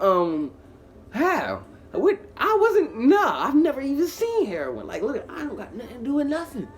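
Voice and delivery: loud, high-pitched acted protest; indignant denial. Suspect denying a heroin bust to police, indignant squeal. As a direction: deny it loudly, voice shooting up.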